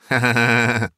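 gloating cackle. Acted. Woman gloats over the money.

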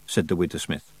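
ordinary delivery

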